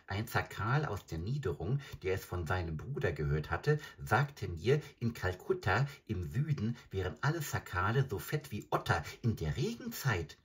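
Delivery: high, quick jackal voice; a hungry jackal chattering eagerly. Acted. Jackal speaks.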